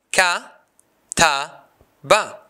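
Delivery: "kataba" split into ka, ta, ba with pauses; syllable by syllable. Shown as a demonstration. Teacher showing the three syllables, syllable by syllable. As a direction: say "Ka, ta, ba" as three separate beats. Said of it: "This word has three syllables".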